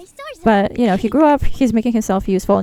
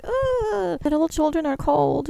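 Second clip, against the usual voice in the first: mildly hammed-up pitying whine; mock distress; 6 semitones higher than usual. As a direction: act out fretting over the little ones. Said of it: "because I was like".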